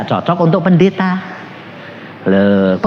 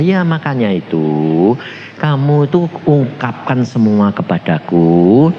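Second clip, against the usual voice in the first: gentle; 9 semitones lower, 4 dB quieter than usual. Retold or imitated. God tells the visitor He understands, gentle and low. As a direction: console quietly, drawing the words out. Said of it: "Lembut, ngomongnya gini".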